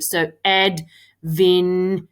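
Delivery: adventure slowed into separate syllables; sounding out words. Shown as a demonstration. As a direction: say adventure ad-ven-ture slowly. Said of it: "When I say it sl-, slowly and break it into syllables".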